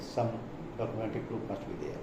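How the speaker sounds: ordinary delivery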